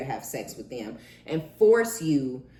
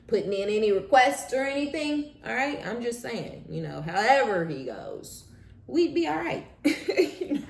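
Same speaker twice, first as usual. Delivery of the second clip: mock innocent aside; 8 semitones higher than usual. Real, not acted.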